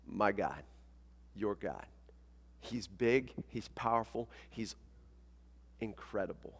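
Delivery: quiet, intimate wonder with long pauses; hushed awe. Real, not acted. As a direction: let the praise sink to a near whisper. Awed praise of God after question.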